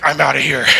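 laughing. Retold or imitated. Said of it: "he's like".

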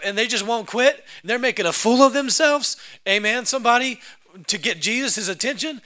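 ordinary talk

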